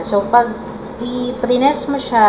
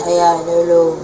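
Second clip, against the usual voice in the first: illumination voice effect; 3 semitones lower than usual. Shown as a demonstration. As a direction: voice through an eerie processing filter. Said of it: "And then, illumination". (nakakatakot na boses sa effect)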